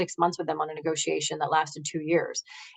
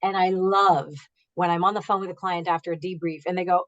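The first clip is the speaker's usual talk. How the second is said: normal talking voice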